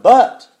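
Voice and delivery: "but" hit hard and loud; stress on but. Shown as a demonstration. Preacher stressing "but" to the congregation. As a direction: punch the word "but" loudly. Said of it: "any time in a statement the word".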